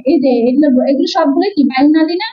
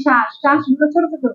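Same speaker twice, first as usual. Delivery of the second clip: ordinary delivery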